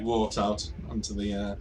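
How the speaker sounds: ordinary talk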